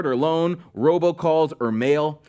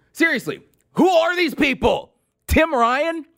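high, incredulous outburst; pitched way up in scorn; exasperated disbelief; 11 semitones higher than usual. Real, not acted. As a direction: demand it as if utterly baffled. Exasperated dismissal of unknown candidates.

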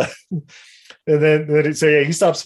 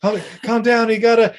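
higher, hurried hero voice trying to calm a friend; Hawk pleading; 8 semitones higher than usual. Retold or imitated. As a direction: talk him down, pitched up. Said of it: "And Hawk's like".

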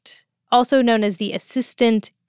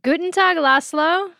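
cheery German greeting; 7 semitones higher than usual. Real, not acted.

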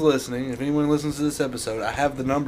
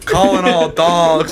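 mock battle cry; 4 semitones higher, 7 dB louder, much slower than usual. Rallying the dogs to attack.

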